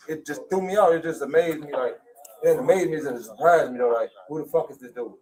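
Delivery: normal talking voice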